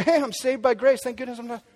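a hint of a smug churchgoer shrugging it off; mock careless believer. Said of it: "You'll say".

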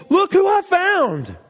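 Jesus announcing his find to everyone; joyful announcement. Retold or imitated. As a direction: show off the found sheep with delight. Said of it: "telling other people".

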